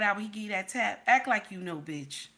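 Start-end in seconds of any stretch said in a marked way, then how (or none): none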